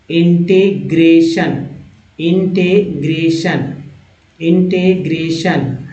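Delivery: "integration" drawn out very slowly, in separate syllables; syllable-by-syllable demo. Shown as a demonstration. Teacher repeating "integration" for learners, very slow and syllabled. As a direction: stretch "integration" part by part for learners. Models a long word syllable by syllable.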